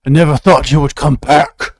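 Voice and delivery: loud, booming villain voice; menacing villain. Acted. Game master as the monster greeting returning heroes, loud villain taunt. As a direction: taunt the intruders loudly in character.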